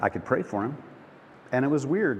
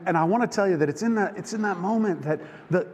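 ordinary talk, nothing marked

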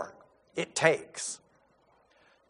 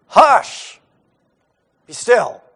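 commanding Jesus; 6 dB louder, much slower than usual. Retold or imitated. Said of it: "the actual words he uses is".

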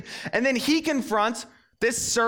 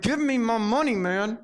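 choking demand; 4 semitones higher, slower than usual. Retold or imitated. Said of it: "He's like".

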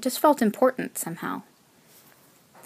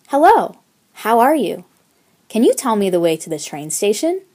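best phrasebook effort; 2 semitones higher, 7 dB louder than usual. A narrator's reading. Student approaching a stranger for directions, bold rehearsed delivery. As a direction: summon courage and recite the learned phrase. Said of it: "said in my best Swedish".

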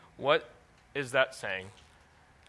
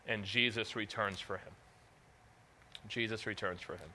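hushed, solemn; 3 semitones lower, 7 dB quieter than usual. Real, not acted. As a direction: let the voice drop for a tender, solemn moment. Solemn telling of Peter's remorse.

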